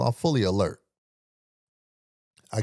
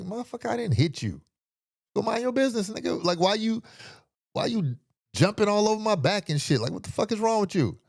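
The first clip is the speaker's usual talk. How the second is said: retelling his retort; 10 semitones higher than usual. Retold or imitated. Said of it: "And I was like".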